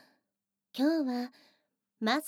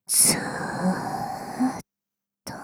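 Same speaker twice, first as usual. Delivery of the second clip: long drawn-out whisper; 6 semitones lower, 6 dB louder, much slower than usual. Acted. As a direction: whisper stretched very slowly. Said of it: "耳元でささやかれても".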